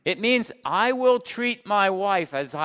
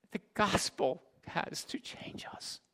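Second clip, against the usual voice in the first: slow, near-whispered pulpit plea; hushed gravity; 3 semitones higher, 9 dB quieter, slower than usual. Real, not acted. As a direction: drop to a slow, weighty whisper. Hushed key point of sermon.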